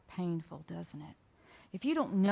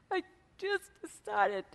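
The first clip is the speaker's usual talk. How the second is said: imitated crying; 3 semitones higher than usual. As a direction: act out a woman starting to cry. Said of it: "she starts crying".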